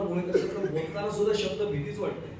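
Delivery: ordinary talk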